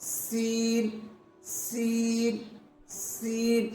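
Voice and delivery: سین with a long whistling hiss on the s, drawn out slowly; سین said slowly with a sharp sibilant hiss; hissing seen. Shown as a demonstration. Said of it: "میرے ساتھ آپ practice بھی کیجیے گا".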